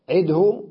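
عده as 'id-hu; original full form. Shown as a demonstration. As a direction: say عده with u after the ه. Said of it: "بدل".

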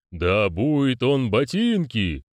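very slow, intoned decree; drawn-out ceremonial command; solemn ritual voice. Acted. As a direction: intone it slowly like a ceremony master. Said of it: "продолжал голос".

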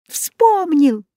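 high, happy exclamation; joyful cry. A narrator's reading. Женчик finally remembers the words, joyful cry. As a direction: burst out with delight, pitched high. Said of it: "радостно закричал Женька".

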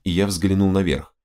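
ordinary delivery, nothing marked

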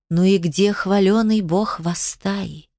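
ordinary delivery, nothing marked

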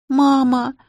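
high little whimper, mildly acted; childlike call with a touch of a sob; sobbing. A narrator's reading. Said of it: "всхлипнула девушка".

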